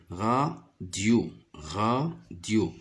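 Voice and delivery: radio split as ra... dio; slow two-beat radio; syllable by syllable. Shown as a demonstration. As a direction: sound out radio with a gap in the middle. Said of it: "ra et dio".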